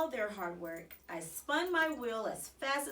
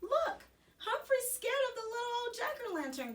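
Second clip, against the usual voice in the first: teasing; 14 semitones higher than usual. A narrator's reading. Teasing classmate mocks hamster.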